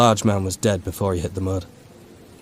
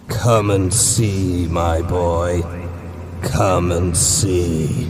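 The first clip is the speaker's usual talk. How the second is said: very slow, sinister beckoning; creature voice; much slower than usual. Acted. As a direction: drag out the invitation eerily. Creature embraces O'Neill.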